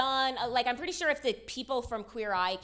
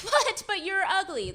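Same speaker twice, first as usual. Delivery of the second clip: mock shocked; 7 semitones higher, 4 dB louder than usual. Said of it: "That'd be like".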